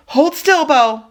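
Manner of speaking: high, loud holler down the crack. Acted. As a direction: yell to freeze the dog in place. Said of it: "I hollered".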